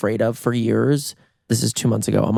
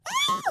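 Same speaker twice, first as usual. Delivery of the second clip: short, very high, thin scream; squealed retelling of a startled scream; imitated scream; 7 dB quieter than usual. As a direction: let out a restrained high-pitched mock scream. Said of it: "we both have the scream".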